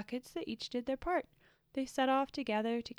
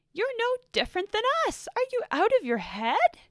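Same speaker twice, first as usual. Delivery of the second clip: much higher and louder, exclaiming; bold retort; 12 semitones higher, 9 dB louder than usual. A narrator's reading. The smallest Spitian calls Blue out, bold and loud. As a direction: call him out, wide-eyed.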